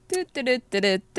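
idle, carefree doo-doo humming in mimicry; mock absent-minded little ditty; oblivious boyfriend. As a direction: imitate a man with nothing on his mind. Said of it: "he's actually just sitting there like".

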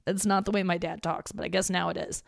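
ordinary delivery

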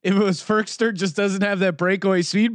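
usual speaking voice

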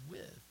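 with said slowly and softly as the key word; stress on with. Shown as a demonstration. Preacher stressing "with" in God's words to Israel. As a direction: lean on the word "with". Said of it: "Emphasis on this word".

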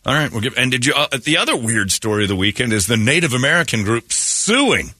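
usual speaking voice

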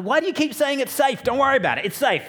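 high, loud, overeager reassurance; overselling. Retold or imitated. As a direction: sell it hard, too cheerful. Overselling again.

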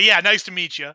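usual speaking voice

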